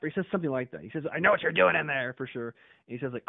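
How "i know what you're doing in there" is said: high, loud imitation of a heckler; mock shout, raised pitch; retold accusing yell. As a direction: call it out like an accuser. Quote repeated, same raised voice.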